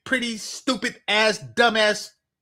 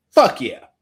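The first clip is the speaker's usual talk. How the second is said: emphatic yes; 4 semitones lower, 8 dB louder than usual. Pretend. Eager answer to job offer.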